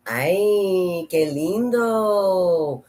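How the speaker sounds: high, drawn-out Mexican Spanish accent; pretend Mexican accent. Shown as a demonstration. Showing off how Spanish sounds, pretend Mexican accent. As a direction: stretch it out slowly in a put-on Mexican accent. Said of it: "I'm gonna pretend that this is Mexican".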